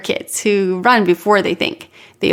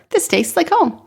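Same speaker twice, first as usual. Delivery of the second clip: put-on higher little girl voice; child voice; 8 semitones higher than usual. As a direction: voice the young daughter. Child Zoe speaks with mouthful.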